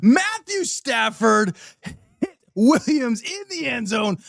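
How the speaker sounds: high, drawn-out touchdown call, building to shouts; live play-by-play. Acted. Fan at home calling the Rams touchdown live, excited play-by-play. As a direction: call the score like a broadcaster as it happens.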